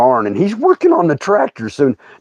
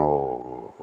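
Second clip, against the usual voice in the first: stunned oh; 13 dB quieter than usual. Retold or imitated. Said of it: "standing there going".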